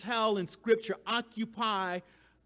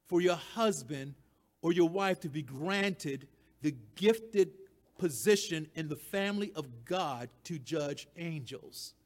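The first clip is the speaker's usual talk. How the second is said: ordinary talk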